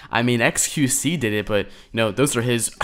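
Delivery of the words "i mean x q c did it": ordinary talk, nothing marked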